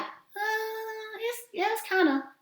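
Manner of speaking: high-pitched, drawn-out unsure hemming; hesitant. Acted. Listener unsure about the mirror exercise, hesitant. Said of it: "If you're like".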